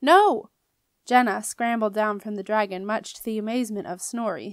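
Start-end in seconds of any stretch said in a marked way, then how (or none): 0.0-0.5 loud high cry; sharp yell. A narrator's reading. Jenna yelling at the dragon again. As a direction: bark the word out to stop him. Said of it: "she yelled".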